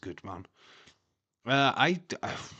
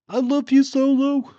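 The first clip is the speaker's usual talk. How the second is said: catchphrase impression; 14 dB louder than usual. Quoting a wrestler's catchphrase.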